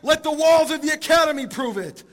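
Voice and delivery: loud, high-pitched cry of challenge; desperate yell. Retold or imitated. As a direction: yell it out as a last-ditch demand. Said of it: "he yells out and he says".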